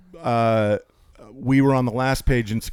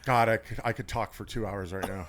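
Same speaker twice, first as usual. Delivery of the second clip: usual speaking voice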